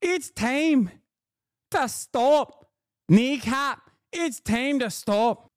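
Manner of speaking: put-on high-pitched Glasgow uni accent, drawn out; mock Scottish accent. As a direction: put on a squeaky, mocking Scottish voice. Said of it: "She has a Glasgow uni accent".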